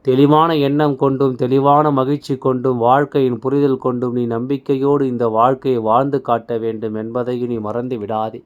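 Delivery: usual speaking voice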